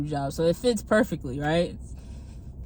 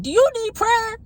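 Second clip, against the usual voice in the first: pointed, higher and louder challenge; confrontational; 13 semitones higher, 6 dB louder than usual. Retold or imitated. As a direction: throw the question at her like a dare. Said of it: "But I ain't gonna say it nicely".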